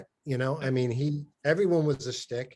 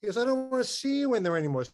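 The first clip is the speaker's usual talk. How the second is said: imitating coach's outburst; 9 semitones higher, faster than usual. Coach ordering player out of weight room, exasperated. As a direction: shoo him out like a fed-up old coach.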